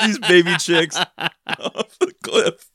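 ordinary delivery

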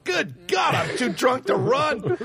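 high, hammed-up cry of dismay; slow, mock-desperate yell; drunken panic. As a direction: wail it while stumbling away drunk. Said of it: "I'm falling down going".

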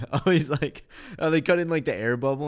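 pumped up recounting the scene; excited. Real, not acted. Excited retelling of whiny Luke.

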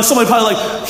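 ordinary delivery

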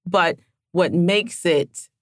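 ordinary talk, nothing marked

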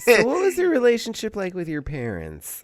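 high-pitched, solicitous counselor voice; gently probe, with hammed-up concern; mock social worker. Social worker counseling a violent villain, absurdly caring. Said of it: "a social worker's gonna show up".

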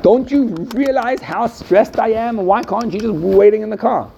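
a retold, hammed-up yell at lazy kids; mock stressed scream. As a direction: play the frazzled mother losing it at the kids. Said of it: "She started screaming at them".

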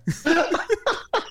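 high burst of laughter; loud laugh. Real, not acted. Cracking up at joke.

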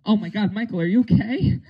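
high, alarmed shout to a friend; panicked cry. Acted. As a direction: panic over a hurt friend. Panicked classmate acted.

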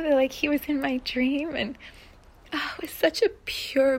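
normal talking voice

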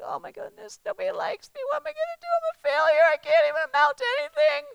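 high, whining mock-despair; whiny self-pity. Woman mocking herself after Kelsey's rejection, high and whiny. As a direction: squeak out a hammed-up pity party.

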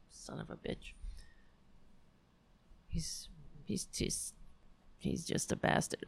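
whispered fond curse; whispered aside. Real, not acted. Whispering a fond curse about her cat, whisper. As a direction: mutter it under your breath.

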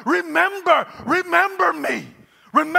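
loud, high, desperate begging; pleading prayer. Acted. Preacher voicing Hezekiah begging God from his sickbed. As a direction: beg God to remember him. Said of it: "this guy's praying".

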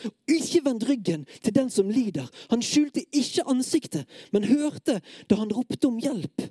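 pleading, emphatic scripture recital; impassioned psalm reading. Acted. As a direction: declaim the lament with urgent feeling.

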